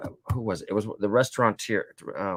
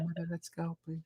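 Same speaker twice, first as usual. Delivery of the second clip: mild embarrassed plea; 9 semitones higher, 13 dB quieter than usual. Retold or imitated. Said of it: "I go".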